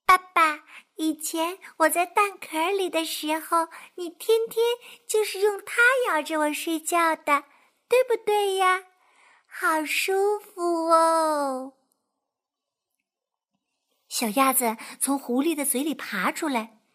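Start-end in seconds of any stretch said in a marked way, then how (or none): 0.1-11.7 put-on higher, sweet little child voice; chirpy duckling. Duckling chatting to the fox about the eggshell. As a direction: coo it contentedly.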